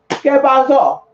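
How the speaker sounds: a touch of the owner barging in; Spanish shop owner demanding; gruff shop owner. Retold or imitated. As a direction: call it out sharp and loud like the owner. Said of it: "the dad come in".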